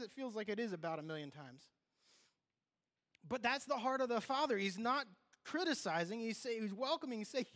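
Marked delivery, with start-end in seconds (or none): 3.3-7.2 fervent preaching, much higher and louder; preacher pressing the point to the congregation, high and loud. Acted. Preaching at full force.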